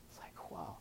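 very soft, breath-only amazement; hushed wonder; awed whisper. Retold or imitated. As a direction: whisper it, floored. Floored after leaving the house.

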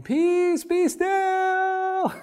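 straining to be heard above the wind; loud cry. Acted. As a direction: yell it over the storm from the boat. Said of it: "he cries as loud as he can".

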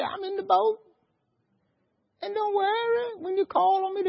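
high, light comforting voice; Jesus. Acted. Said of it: "And Jesus said".

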